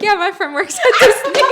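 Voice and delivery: high, laughing retold aside; laughing mock line. Friend tells cohost about her fib, laughing. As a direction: giggle through the fake claim. Retelling her own offhand lie.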